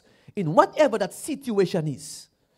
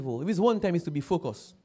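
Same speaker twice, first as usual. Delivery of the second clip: ordinary talk, nothing marked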